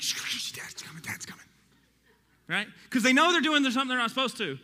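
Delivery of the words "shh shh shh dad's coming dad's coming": hushed, breathy whisper, voice dropped away; kids whispering. Retold or imitated. Kids hushing each other as Dad comes upstairs, whispering. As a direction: whisper urgently so Dad won't hear. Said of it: "and then, like".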